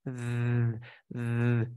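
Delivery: "Z" as a long buzzed voiced th; drawn-out voiced th sound for "Z"; tongue between teeth. Shown as a demonstration. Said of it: "el truco de la lengua entre dientes".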